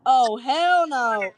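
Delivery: slow, drawn-out mock refusal; flat refusal. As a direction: drag it out like a hard no. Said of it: "and he goes".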